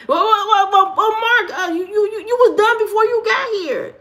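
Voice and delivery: high, loud sassy put-on voice; well-well-well like a sassy auntie; taunting a viewer in a put-on voice; mock scolding.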